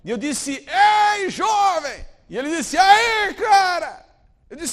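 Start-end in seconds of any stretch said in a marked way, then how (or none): 0.7-2.0 loud high call across a distance; retold desperate shout to a young man; shouting from afar. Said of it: "eu gritei de longe". 2.8-4.0 loud, high, cheerful yell; thrill-seeker yelling back; carefree shout back. Retold or imitated. Young man answers the shout.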